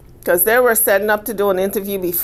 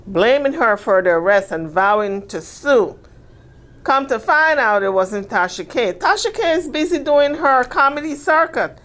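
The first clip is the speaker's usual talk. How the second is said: ordinary talk, nothing marked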